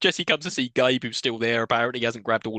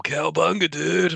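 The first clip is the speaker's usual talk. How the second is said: nineties dude; 4 semitones higher than usual. Retold or imitated. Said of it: "those ninety dudes are here".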